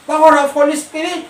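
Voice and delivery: drawn out, high and loud; a preacher booming the Holy Spirit's power; slow, proclaimed. Acted. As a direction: proclaim each word slowly with force. Preacher's English climax phrase.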